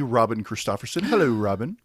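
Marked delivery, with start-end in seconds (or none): 1.0-1.8 a light take on Sean's sing-song hello; imitating Sean. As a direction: greet like Sean would, rising at the end. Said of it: "I did a Sean there".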